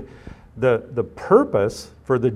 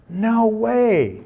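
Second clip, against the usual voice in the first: No way, pitched much higher in mock disbelief; incredulous refusal; 9 semitones higher than usual. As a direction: play Peter refusing flatly, high and scoffing. Peter's refusal voiced in retelling.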